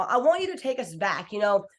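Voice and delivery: ordinary talk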